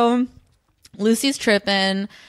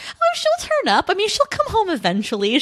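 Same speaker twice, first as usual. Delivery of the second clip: breezy and much higher, no worry at all; chill, unbothered; 13 semitones higher than usual. Retold or imitated. Annie shrugging off Eric's worry about Lucy, breezy and unbothered. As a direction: brush it off lightly like it's nothing. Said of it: "so chill about it".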